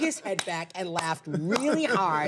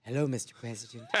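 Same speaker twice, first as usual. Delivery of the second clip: greeting the president; 8 semitones lower, 7 dB quieter, faster than usual. Retold or imitated. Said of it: "you wanna hear my Barack Obama moment".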